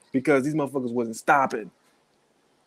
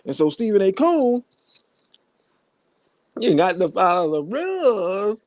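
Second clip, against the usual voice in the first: mock preachy lecture; 6 semitones higher than usual. Mock lecture aimed at Stephen A.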